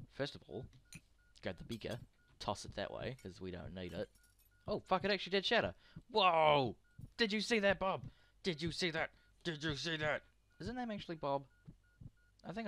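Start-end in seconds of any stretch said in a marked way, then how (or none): none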